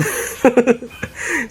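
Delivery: gleeful mocking laugh. Real, not acted. Gamer laughing at a fleeing enemy, mocking laugh.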